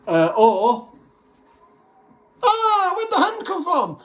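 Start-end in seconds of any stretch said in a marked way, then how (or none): 2.4-4.0 high startled yelp, hammed-up; mock startled scream. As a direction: pretend fright, pitched way up. Mock scared victim.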